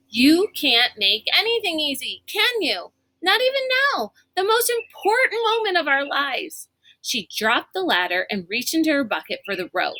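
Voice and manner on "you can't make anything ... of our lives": exasperated. A narrator's reading. Kate exasperated at Constance.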